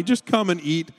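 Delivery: ordinary talk, nothing marked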